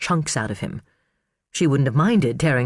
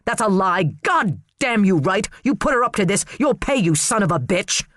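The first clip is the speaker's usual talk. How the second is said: angry outburst; 5 semitones higher than usual. Acted. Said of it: "voice rising above the others".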